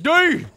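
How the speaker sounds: high, loud yelped D; goofy falling yelp; silly D noise. Real, not acted. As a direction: yelp it like a cartoon fall. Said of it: "I make that when I fall down".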